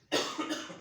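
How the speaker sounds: a short dry cough shown as an example; demo cough. Shown as a demonstration. Lecturer showing students a COPD patient's cough, a short demo cough. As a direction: cough once to show the COPD cough. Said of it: "जैसे मेरी खांसी".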